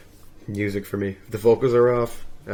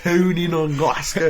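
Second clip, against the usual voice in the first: high, slow mock Scottish; Scottish accent; 6 semitones higher, 3 dB louder, slower than usual. Shown as a demonstration. Practising Scottish for a laughing friend, mock accent. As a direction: draw it out in a hammed-up Scottish accent. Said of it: "Scottish is the one I've tried to".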